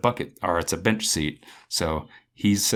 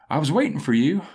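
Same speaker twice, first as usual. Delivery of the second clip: higher, louder voice from nowhere; raised, eerie-stranger impression; mystery voice; 8 semitones higher, 5 dB louder than usual. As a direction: imitate an unseen stranger calling out. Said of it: "And the voice said".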